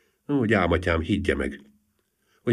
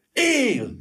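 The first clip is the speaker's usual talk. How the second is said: "Él!" cried out much higher, bursting with relief; elated cry. A narrator's reading. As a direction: shout "Él!" as if electrified with joy. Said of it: "Kiáltott fel Gyuri felvillanyozva".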